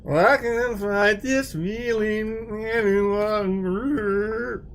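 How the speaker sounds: slow, wavering warped-tape voice; wobbly tape warble. Retold or imitated. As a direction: imitate a stretched old cassette. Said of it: "That's what it sounded like".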